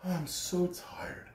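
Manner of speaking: slow, soft and tired; old engine sighing that he is too tired; weary sigh. A narrator's reading. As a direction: let the tiredness drag. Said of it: "the rusty old engine sighed".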